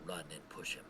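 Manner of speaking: very soft, low murmur; whispering. Acted. Player answers Killian's doubt in a low whisper. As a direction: answer under your breath, hushed. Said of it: "I'm gonna whisper to Killian".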